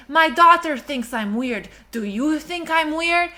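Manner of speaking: lightly imitated Romanian dad accent, slowed down; slow mock-innocent question in dad's Romanian accent. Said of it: "I'm trying to do your accent".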